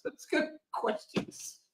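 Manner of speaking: slow, high whisper. Real, not acted. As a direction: breathe it out slowly. Whispered aside.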